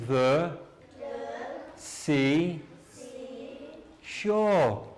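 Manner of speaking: each word said alone with a pause; one word at a time. Shown as a demonstration. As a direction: model it word by word, slowly. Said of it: "repeat after teacher first time".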